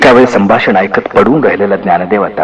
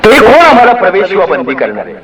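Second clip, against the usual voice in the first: higher pitched angry cry; angry shout; 9 semitones higher than usual. A narrator's reading. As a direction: fling the question out in rage. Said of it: "चडफडला आणि ओरडला".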